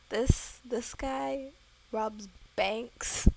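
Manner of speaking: loud, emphatic outburst in bursts; real incredulous exclamation; loud disbelief. Real, not acted. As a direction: announce it in loud amazement, breaking between words. Amazed reaction to bank-robber ghost.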